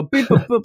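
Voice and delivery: high beeping noises; computer beeps. Retold or imitated. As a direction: beep like an old computer. Imitating computer beeps again.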